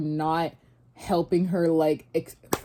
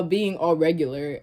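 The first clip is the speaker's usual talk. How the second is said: ordinary talk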